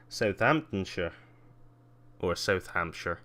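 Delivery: usual speaking voice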